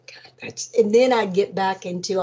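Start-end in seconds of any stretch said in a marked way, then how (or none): 0.1-0.7 breathy hushed aside; awed whisper; whispered thought. Retold or imitated. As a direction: whisper it to yourself.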